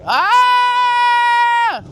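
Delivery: high loud yelp on the curve; sudden high shout of thrill; excited shout. Real, not acted. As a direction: let out a thrilled yell.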